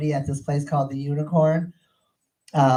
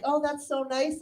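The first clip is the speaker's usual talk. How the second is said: high, soft, sugary imitation; gushing admirer; 10 semitones higher, 6 dB quieter than usual. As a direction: coo in a mock-sweet high voice. Imitates gushing people praising her.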